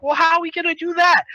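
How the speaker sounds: high-pitched mock puzzled voice; puzzled question. Kid asking how to open the door, high puzzled voice. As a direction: ask it high, as the doubting kid.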